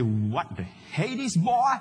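put-on drawn-out Texan exclamation, rising high on BOY; big slow Texan drawl; Texan accent. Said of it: "Knowing his Texan background".

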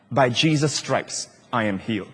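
sense of love. Shown as a demonstration. Preacher modelling confession with a sense of love. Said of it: "With a sense of his love for you".

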